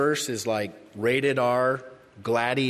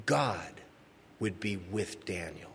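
hushed emphasis; 5 semitones lower, 7 dB quieter than usual. Real, not acted.

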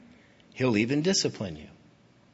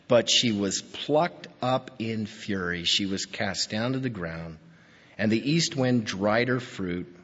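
measured, lower scripture reading; 4 semitones lower than usual. Acted. Preacher reading Ezekiel's lament to the congregation, solemn. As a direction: read the lament slowly and gravely.

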